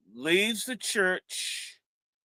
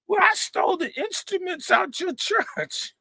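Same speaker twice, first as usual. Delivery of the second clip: much higher confession with a laugh inside; sheepish thief; 12 semitones higher than usual. Retold or imitated. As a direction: own up to it, high and sheepish.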